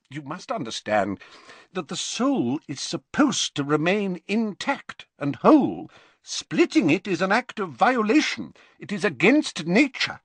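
high-pitched, uneasy professor voice; uncomfortable. A narrator's reading. Professor explains splitting the soul to his pupil, uncomfortable. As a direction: rise in pitch and force while explaining reluctantly. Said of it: "said Slughorn uncomfortably".

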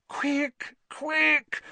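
high, strained pleading; anxious moan. Acted. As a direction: moan it in panic. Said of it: "Hermione moaned".

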